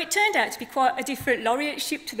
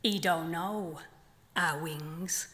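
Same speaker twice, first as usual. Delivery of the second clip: measured poem delivery with long pauses; poem reading; 5 semitones lower, 4 dB quieter, slower than usual. Acted. Poet reading her flamingo poem to the festival audience, measured. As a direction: let each line land, unhurried.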